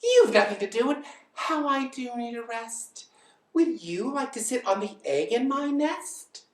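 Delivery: high, coaxing bird voice; smiling lazy bird. Acted. Bird asks elephant to sit, high smiling coaxing. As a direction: sweet-talk with a big smile, pitched high. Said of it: "smiling her best".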